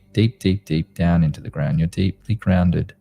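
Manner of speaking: sleep story narrator. Acted. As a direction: soothe them gently into calm. Leads a guided meditation.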